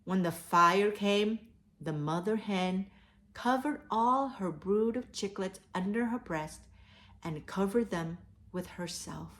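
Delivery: plain reading voice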